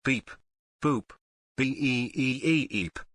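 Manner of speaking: stuttering malfunctioning robot voice; glitching robot. Pretend. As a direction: glitch and repeat like a broken machine. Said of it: "Error. System malfunction".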